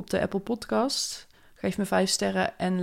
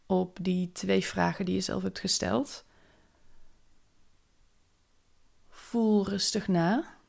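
calm, unhurried meditation voice; guided meditation. Acted. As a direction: guide them gently into the day. Leads a morning meditation. (rustige meditatiestem)

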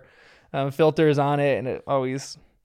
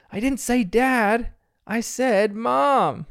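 put-on slow, higher-pitched kid's protest; a little feisty; 7 semitones higher, much slower than usual. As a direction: imitate an indignant little girl, stretching the words. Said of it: "with a little feistiness".

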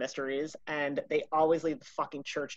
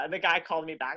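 ordinary talk

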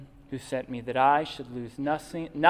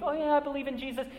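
mock-casual, much higher and quick; hammed-up offhand believer, no depth; glib lip service; 10 semitones higher, much faster than usual. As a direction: rattle it off like a shallow believer. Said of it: "don't just have some intellectual belief".

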